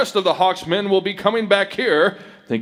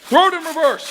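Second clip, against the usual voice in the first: urgent shouted order; 12 semitones higher than usual. Acted. Masked man ordering Dale to reverse the train, shouting. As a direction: shout the command, much higher. Engineer commands the crash manoeuvre.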